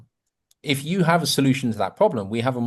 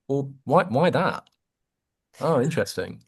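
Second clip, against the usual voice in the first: genuinely curious; 3 semitones higher than usual. Shown as a demonstration. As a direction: wonder aloud, then react. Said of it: "Like, again".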